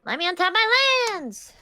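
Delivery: high loud playful yell; excited shout. Real, not acted. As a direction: blurt it out gleefully.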